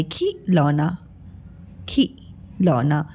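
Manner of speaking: खिलौना said low and slow, short इ sound; careful slow model. Shown as a demonstration. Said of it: "आई की sound आ रही है". (धीरे धीमे स्वर में: खिलौना)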